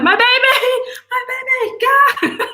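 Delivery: high, laughing cry over the dog; half-laughing panic for the pet; mock panicked cry. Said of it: "I started screaming".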